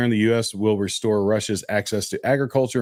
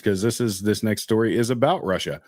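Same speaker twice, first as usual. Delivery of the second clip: ordinary delivery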